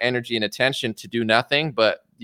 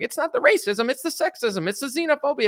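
much higher sing-song mock talking points; 13 semitones higher than usual. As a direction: lampoon a preachy list of buzzwords. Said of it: "he said it in that voice".

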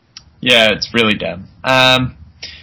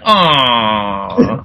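disappointed aw; 3 semitones lower than usual. Retold or imitated. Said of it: "And they're like".

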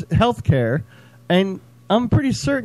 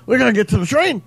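Liam impression; 6 semitones higher than usual. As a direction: push the Liam voice up for the crowd. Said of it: "Doing an impression of Liam".

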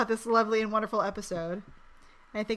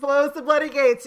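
loud proclamation; 4 semitones higher, 10 dB louder than usual. Acted. Loud shouted toast.

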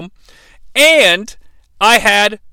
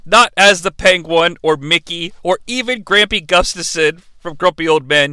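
ordinary delivery

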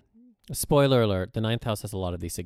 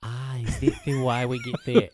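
high, hammed-up Australian accent; louder, giggly mock-Australian; 5 semitones higher, 5 dB louder than usual. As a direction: ask it as a badly faked Australian, laughing. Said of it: "the worst Australian accent".

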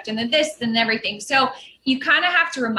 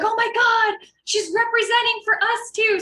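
much higher, gushing proud relatives; imitating excited relatives; 10 semitones higher than usual. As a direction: squeal it like thrilled family abroad. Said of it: "my Colombian side or my Spanish side are like".

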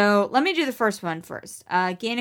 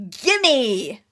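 higher, grabby outburst; demanding gimme; 5 semitones higher, 3 dB louder than usual. Acted. Player grabbing at the power, demanding outburst. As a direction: blurt it out as a greedy demand. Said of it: "that's me saying "gimme," not, not Max saying".

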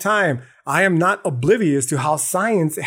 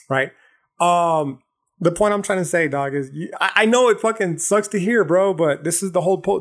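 ordinary talk

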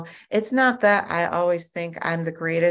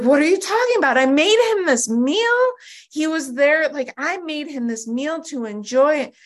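indignant protest; 8 semitones higher, 3 dB louder than usual. Retold or imitated. Defending herself to sponsor.

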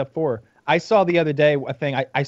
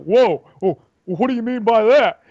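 higher, louder mock offense; offended Stern; 8 semitones higher, 5 dB louder, slower than usual. Stern insulted by a guest, higher offended whoa. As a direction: bristle like a touchy host. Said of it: "he's like".

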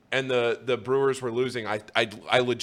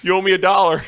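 raised, louder mock voice, laughing through it; hammed-up gruff demand; imitating the guy; 7 semitones higher, 8 dB louder than usual. Slot guy telling Paul he owes him, mock loud. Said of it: "he turns around and he goes".

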